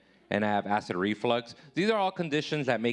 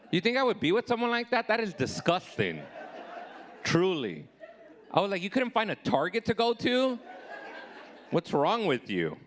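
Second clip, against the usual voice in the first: high, indignant disbelief; comic rant, pitching up in disgust at her; mock outrage; 9 semitones higher than usual. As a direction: act scandalised at her.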